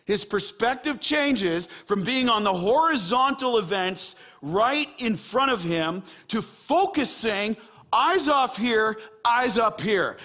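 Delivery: punchy, stretched-out sermon emphasis; forceful preaching. Real, not acted. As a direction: drive each phrase home to the congregation.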